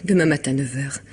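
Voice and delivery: usual speaking voice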